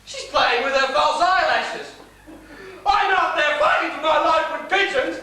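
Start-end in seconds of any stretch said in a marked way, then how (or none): 2.8-5.2 angry rant. Acted. Father ranting about daughter.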